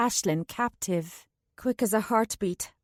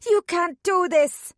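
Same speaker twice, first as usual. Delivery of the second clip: high, loud, slow desperate cry; desperate shout; 8 semitones higher, 6 dB louder than usual. A narrator's reading. Ashlyn shouts while held fast, high and desperate. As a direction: fight them with your voice. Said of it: "she thrashed and fought".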